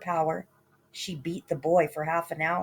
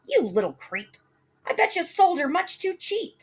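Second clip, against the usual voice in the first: sneering mother, much higher at the end; scornful mother; 12 semitones higher than usual. Acted. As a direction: scoff at the foolish boy. Mother insulting son.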